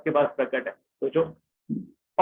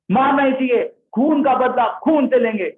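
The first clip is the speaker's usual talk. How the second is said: raised, loud call for blood revenge; vengeful shout; 6 semitones higher, 4 dB louder than usual. Acted. Bhima insisting on revenge against Ashwatthama, loud angry shout. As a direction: bark it out like a wronged warrior.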